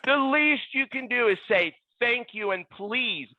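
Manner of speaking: high-pitched, emphatic retort; indignant scolding. Retold or imitated. Ball boy scolding a star player.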